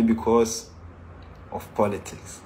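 breathy whisper, voice dropped away; whispered aside. Real, not acted. Teacher to listeners, confiding a sore point in a whisper. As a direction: let the cause slip out under the breath.

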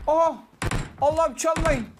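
high, loud urgent cry; excited plea. Real, not acted. Gamer urging teammates as an enemy appears, excited cry. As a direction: plead in a rush of alarm.

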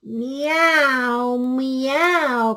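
long, loud meows; crying cat. Acted. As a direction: meow out like a calling cat. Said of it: "cried the cat".

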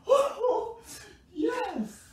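high, laughing yells; victory cheering. Real, not acted. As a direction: cheer the win at the top of your voice. Celebrating the win.

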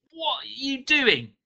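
high, loud, drawn-out strained cry; through clenched teeth. A narrator's reading. Mugger gripping the burning knife, straining through clenched teeth. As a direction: force it out through gritted teeth. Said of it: "he said through clenched teeth".

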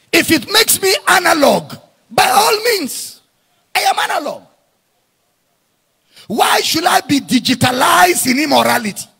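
loud rising preacher's cry, hammering each line; preacher shouting to his congregation, fervent and loud; full-force preaching. Acted.

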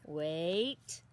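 ordinary delivery

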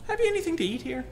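high, mild casual request; deadpan joke question in a high voice; comic innocent question. Acted. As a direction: ask it lightly, out of nowhere. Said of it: "now here's the joke".

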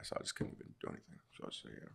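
2 fast whispered trailing off; 3 let it fade into a whisper; 4 resigned whisper about bad knees. Real, not acted.

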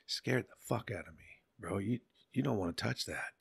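ordinary talk, nothing marked

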